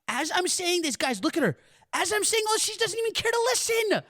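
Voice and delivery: exasperated complaint. Real, not acted. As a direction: complain in a squeaky voice at being ignored. Complains mother isn't listening.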